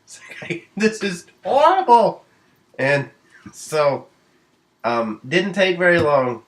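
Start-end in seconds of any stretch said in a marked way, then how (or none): 0.0-2.2 mock panic. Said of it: "And I'm, I'm like".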